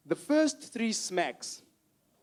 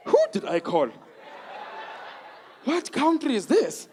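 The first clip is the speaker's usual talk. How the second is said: confused caller; 4 semitones higher than usual. Retold or imitated. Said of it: "the person's still there on the other end going".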